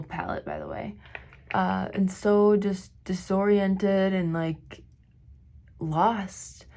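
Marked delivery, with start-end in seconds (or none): 0.0-1.0 breathy unvoiced product name; whispered aside. Real, not acted.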